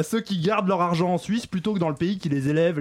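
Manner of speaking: ordinary delivery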